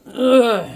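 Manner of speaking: loud, high grunt from smoke exposure; loud throat-clearing. Real, not acted. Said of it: "got a little bit of, uh, exposure to, to smoke".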